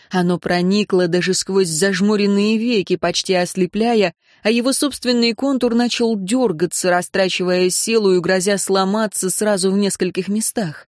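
ordinary delivery, nothing marked

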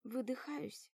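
plain reading voice

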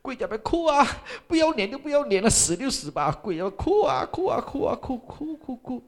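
high, loud wailing repetition; retold sobbing. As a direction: wail the memory of weeping. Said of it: "我哭啊". (高声哭喊般地重复)

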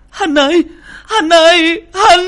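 high, loud howl for her daughter; desperate scream. Acted. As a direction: scream the name in despair. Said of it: "Mụ gào lên".

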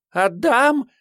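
high, eager squeal. Acted. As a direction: squeal agreement. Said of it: "согласно взвизгнула".

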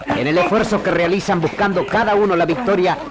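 usual speaking voice

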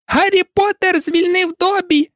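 very high piercing elf squeal; shrieking house-elf. A narrator's reading. Dobby shrieking with joy at Harry. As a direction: shriek it out in delight. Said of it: "пронизливо заверещав ельф".

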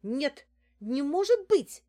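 high, loud, slow astonishment; disbelief. A narrator's reading. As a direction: refuse to believe it, wide-eyed. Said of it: "распахнула глаза Неделькина". (изумлённо, не веря)

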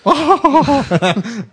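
loud high burst of laughter; big laugh. Real, not acted. Laughing at a crude joke.

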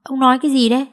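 wife can't believe her ears; astonished. A narrator's reading. Wife reacts to husband's odd plan, astonished. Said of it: "Bà Hương tròn mắt".

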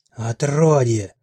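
the witch roars at the heroine, drawn-out furious bellow; witch roaring. A narrator's reading. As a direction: bellow the curse, dragged out. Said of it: "вдруг заревела ведьма".

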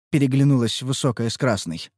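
plain reading voice

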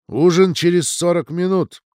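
loud grumpy call; gruff grunt. A narrator's reading. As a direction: announce it gruffly, like a stern matron. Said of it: "буркнула бегемотиха".

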